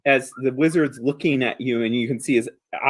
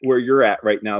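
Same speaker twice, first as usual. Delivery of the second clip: ordinary delivery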